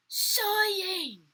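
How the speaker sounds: loud, high, stretched-out call; high storytime holler; children crying out. A narrator's reading. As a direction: sing it out like a class answering. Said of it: "I know what they cried out".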